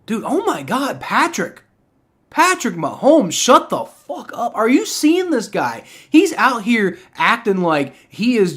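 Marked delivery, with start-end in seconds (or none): 0.0-5.8 real irritation, pitched up and dragged out; exasperated outburst. Real, not acted. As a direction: yell it at the quarterback, fed up. Ranting at Mahomes on stream.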